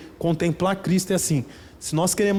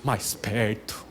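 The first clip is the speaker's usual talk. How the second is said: mock prayer voice to a friend; 6 semitones lower than usual. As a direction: pray at your buddy, mocking it. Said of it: "fala assim".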